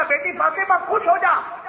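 high, bright joyful father's voice. A narrator's reading. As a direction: announce the good news with joy. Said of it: "بڑی خوشی سے کہا".

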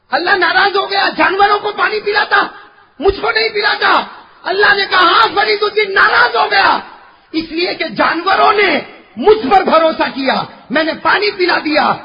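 very high, shouted sermon dialogue; full-force preaching. Acted. Preacher voicing Allah answering the weeping saint, impassioned shout. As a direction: cry out each line to the crowd.

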